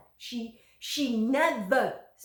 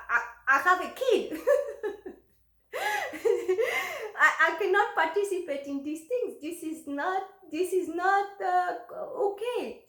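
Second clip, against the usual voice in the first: incredulous laughing; 7 semitones higher, 4 dB louder than usual. Real, not acted. Laughs in disbelief at request.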